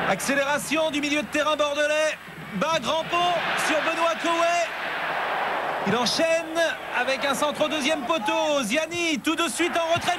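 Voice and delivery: high, urgent play-by-play; excited sports commentary. Real, not acted. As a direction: call the move up to the crossbar. Fast attack hitting the bar.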